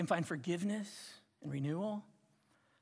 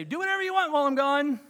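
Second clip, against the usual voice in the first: much higher, louder, fast careless parent; breezy parent waving the kids off; permissive parent; 10 semitones higher, 11 dB louder, faster than usual. Retold or imitated. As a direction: toss it off like a lax parent. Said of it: "you're just gonna say".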